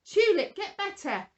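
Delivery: big grown-up voice. Shown as a demonstration. Presenter commanding the dead tulip to heal, loud grown-up voice. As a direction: boom it out like a bossy grown-up ordering the tulip. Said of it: "in a very big grown-up voice".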